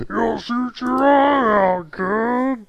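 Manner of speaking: high, slow, loud demon voice; demonic voice. Shown as a demonstration. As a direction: drag it out like a demon. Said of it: "a demonic voice to that".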